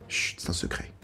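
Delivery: hushed conspiratorial whisper. Pretend. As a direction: whisper it like a secret. Mock secret aside to viewers.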